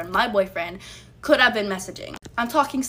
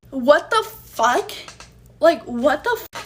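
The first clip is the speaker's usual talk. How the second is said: slow, louder, higher what the fuck; outraged disbelief; 5 semitones higher, 5 dB louder, slower than usual. Real, not acted. Telling viewers about finding the messages, outraged disbelief. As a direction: let the shock spill out.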